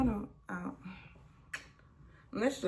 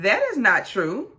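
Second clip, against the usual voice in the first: louder, firm denial; retold retort; 4 semitones higher, 8 dB louder than usual. Janae denying Kenny's claim, loud retort. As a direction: shoot it down hard.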